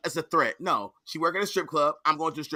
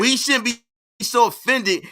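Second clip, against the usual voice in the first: mocking quote; 5 semitones higher, 4 dB louder, slower than usual. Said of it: "this is the most sensitive motherfucker".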